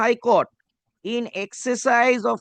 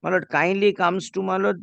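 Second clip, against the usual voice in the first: ordinary talk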